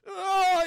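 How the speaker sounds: long, loud, high "oh"; exasperated groan. Acted. Peter confessing to Mary Jane, exasperated groan. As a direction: draw out a high, anguished groan.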